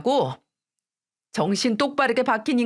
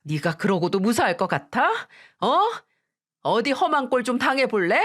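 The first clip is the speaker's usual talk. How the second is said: higher, menacing scolding; mother defending her daughter, threatening the mother-in-law; furious threat; 4 semitones higher than usual. Acted. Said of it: "제대로 화를 내기 시작했어요".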